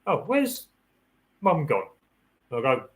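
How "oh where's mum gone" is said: high-pitched voice for a wondering dog; puzzled dog. Acted. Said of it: "the dog would go".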